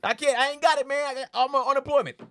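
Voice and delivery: high, loud hammed-up shout; mock desperate cry. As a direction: plead like someone dangling off a building. Falling victim crying out.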